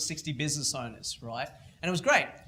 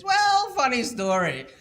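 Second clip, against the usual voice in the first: smug triumph; 7 semitones higher, 6 dB louder than usual. Retold or imitated. Kid tells his mentor he's CEO again, smug and triumphant. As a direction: savor the reveal like a victory lap. Kid's triumphant punchline, voiced.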